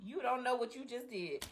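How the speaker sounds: soft threatening aside; quiet aside. Real, not acted. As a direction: drop it to an ominous murmur.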